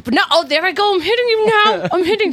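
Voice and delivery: high-pitched, hammed-up fake fight; mock outburst. Pretending to hit a girlfriend in public, mock outburst. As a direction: play losing control, voice jumping up. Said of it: "we fake fight".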